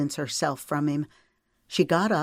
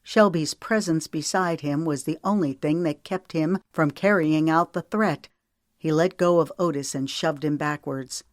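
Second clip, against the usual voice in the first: ordinary delivery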